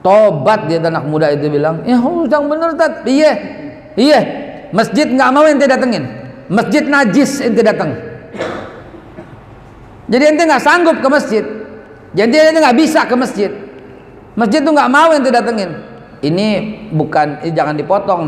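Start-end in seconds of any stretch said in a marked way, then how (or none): 1.8-3.0 high-pitched, imitated plea of a young man; retold tearful question. Young man questions his ustaz, mock tearful. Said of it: "Nangis aneh bilang begitu". 3.0-7.9 high, insistent scolding; retold stern rebuke. Ustaz confirms his verdict to the youth, stern rebuke. Retold harsh answer to young man. 10.1-15.7 high-pitched, emphatic accusation; stern retold rebuke. Ustaz tells youth the mosque rejects him, stern rebuke.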